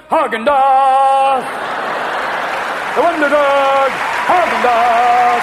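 slow, loud, grand Häagen-Dazs; announcer voice. Pretend. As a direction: proclaim it like a movie trailer. Ice cream as the savior.

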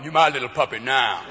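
low-voiced menacing cellmate; Bubba. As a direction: claim your new cellmate in a low voice. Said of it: "a man named Bubba going".

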